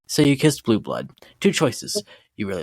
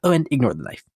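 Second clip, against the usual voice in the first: normal talking voice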